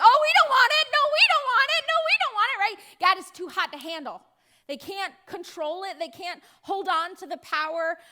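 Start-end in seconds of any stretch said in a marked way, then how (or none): 0.0-2.6 high, loud, frantic refusal; panicked Philistines. Retold or imitated. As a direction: push it away like a hot potato, high and shrill-loud. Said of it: "they're like".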